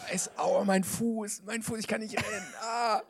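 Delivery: whining. Acted. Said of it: "Es wird viel gejammert in diesem Film".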